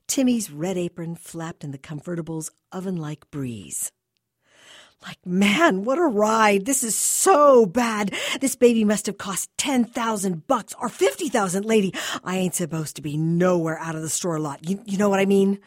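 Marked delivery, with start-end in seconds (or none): 5.0-15.6 put-on higher, much louder slangy teenager; thrilled teen boy riding in the Jag; excited teen boy. A narrator's reading. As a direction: gush like a wide-eyed kid in a fancy car. Teen boy voiced in story.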